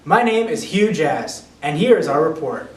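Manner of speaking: slow, loud announcer intro; news anchor intro. Acted. Anchor opens the mock broadcast.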